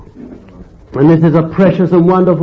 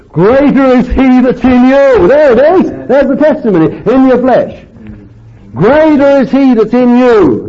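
much higher and louder, ringing proclamation; preaching at full force; 10 semitones higher, 4 dB louder than usual. Acted. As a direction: cry it out as the sermon's peak. Preacher's climax on scripture.

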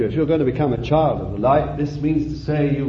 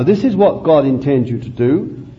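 ringing call with long pauses; solemn, spaced-out pulpit summons; preacher's appeal; 5 semitones higher, 5 dB louder than usual. Real, not acted. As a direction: proclaim each phrase on its own. Preaches appeal in separated phrases.

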